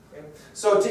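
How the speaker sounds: usual speaking voice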